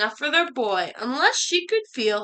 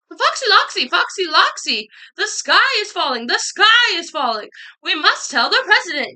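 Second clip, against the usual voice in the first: higher, raised-voice alarm; urgent yell; 6 semitones higher, 4 dB louder than usual. A narrator's reading. Birds meeting Foxy Loxy outside the airport, urgent yelling. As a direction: call out the emergency. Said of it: "yelled Chicken Licken".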